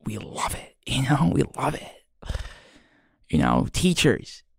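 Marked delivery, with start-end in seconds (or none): 0.1-0.8 soft whispered quote; quoting praise softly. Retold or imitated. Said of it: "so many people be like". 1.3-2.4 soft quote. Retold or imitated. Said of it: "so many people be like".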